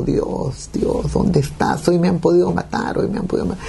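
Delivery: strained high cry to God, no real volume; retold crying out. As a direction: re-enact a desperate call, drawing out the words. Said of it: "Comienzo a gritar y le digo".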